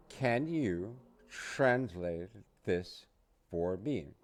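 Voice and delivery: "Can you translate this for me" drawn out slowly, word by word; slow it right down so learners can follow; slow model. Shown as a demonstration. Teacher says "Can you translate this for me" slowly for learners to repeat. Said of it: "You say".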